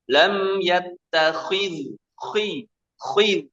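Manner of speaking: yattakhil khi very slow, overly heavy; overthick wrong demo. Shown as a demonstration. Teacher demonstrating the wrong overthick reading. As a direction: exaggerate the khi thickness, slowly. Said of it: "Nah, itu keliru".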